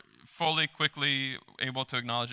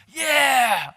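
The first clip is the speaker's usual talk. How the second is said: big shout of victory, much higher and louder; triumphant yell; 10 semitones higher, 6 dB louder than usual. Retold or imitated. Pastor imitating people at the top, triumphant yell. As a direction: whoop it like you reached the summit. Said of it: "we get to the top and we're like".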